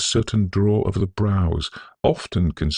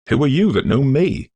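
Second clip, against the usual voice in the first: startled question; 10 semitones higher, 5 dB louder than usual. A narrator's reading. Startled young man turns round.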